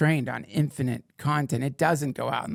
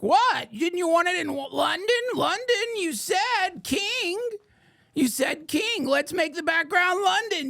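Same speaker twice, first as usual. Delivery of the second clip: much higher, squeaky eager-helper voice; clueless AI voice. Acted. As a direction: play an overeager AI that got it all wrong. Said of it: "And it's like".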